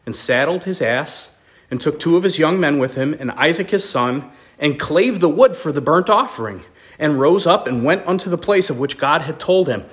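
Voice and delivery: ordinary delivery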